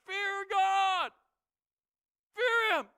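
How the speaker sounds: high, loud shout to the crowd; full-voiced shout. Old Joshua shouts to his people, full force. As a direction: bellow it out to the whole nation. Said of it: "giving it all he had".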